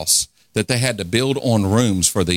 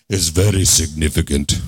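much lower, deep Trinidadian Uncola voice; deep Trinidadian accent; 6 semitones lower than usual. Retold or imitated. Preacher imitating his friend from Trinidad reading scripture, deep Trinidadian accent. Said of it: "Sounds like the Uncola".

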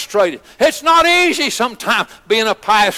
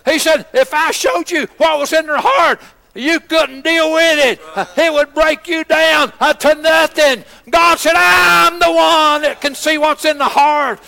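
fiery preaching; 6 semitones higher than usual. Acted. Pastor voicing God's word to congregation, fiery full-force preaching. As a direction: drive every line home from the pulpit.

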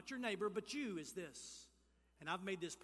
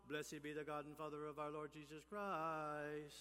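mock solemn reading; 5 semitones lower, 5 dB quieter than usual. Shown as a demonstration. Preacher showing how not to read Paul, mock solemn intoning. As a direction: intone it like a dull liturgy. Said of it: "you don't need to read it as if".

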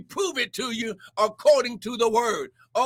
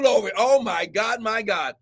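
ordinary talk

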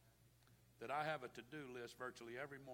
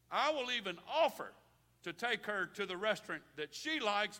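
loud, higher-pitched preacher's delivery; full-force preaching; 6 semitones higher, 13 dB louder than usual. Real, not acted. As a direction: proclaim it to the whole congregation. Preaches about marriage at full force.

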